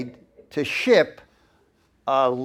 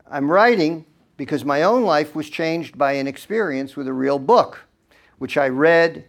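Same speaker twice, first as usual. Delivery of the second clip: reading a letter aloud; 4 dB louder than usual. Acted. Reads his own letter aloud.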